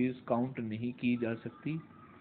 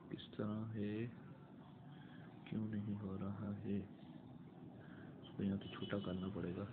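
much softer, off-hand self-talk; muttering to self; 2 semitones lower, 11 dB quieter than usual. Real, not acted. Teacher to self, puzzling over something not working, muttering. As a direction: think aloud under the voice.

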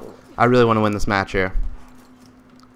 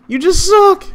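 high, loud yelled jab; sharp shouted put-down; loud insult; 6 dB louder, slower than usual. Real, not acted. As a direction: yell it way up high at him. Insulting teammate.

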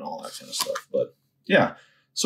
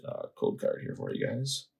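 whispered aside; 6 semitones higher, 9 dB quieter than usual. Real, not acted. Aside while opening pack.

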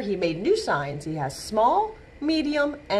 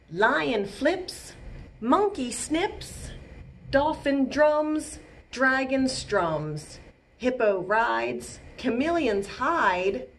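slow, sing-song rhyming poem; slow rhythmic poem for kids; poem reading; 4 semitones higher, slower than usual. A narrator's reading. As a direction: read each line slowly with a bounce.

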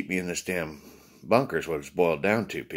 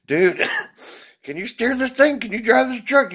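much higher and louder, panicky questions; alarmed; 8 dB louder than usual. Retold or imitated. Panicking at his partner driving the truck, high alarmed cries. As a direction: yelp at a bad driver.

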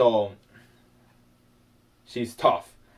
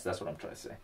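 breathy whispered aside; 8 semitones lower, 16 dB quieter, faster than usual. Real, not acted. Painter whispering to himself about his ocean. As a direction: drop to a whisper under the breath.

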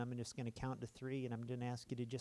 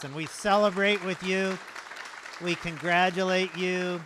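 much higher and much louder, exuberant; celebration; 6 semitones higher, 14 dB louder than usual. Real, not acted. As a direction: shout the celebration to the whole room. Celebrating nine new believers.

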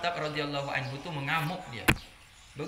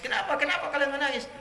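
higher-pitched indignant questioning; angry Umar; 7 semitones higher than usual. Retold or imitated. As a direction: confront the mourners, pitch raised. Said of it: "Marah Umar, bukan menangis". (menirukan marah, nada tinggi)

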